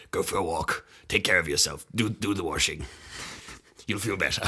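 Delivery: low, soft, soothing voice; gentle Venom. Acted. Gentle symbiote looking after its host, softly caring. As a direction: coax kindly, quiet and deep. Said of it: "it's, like, a real gentle one".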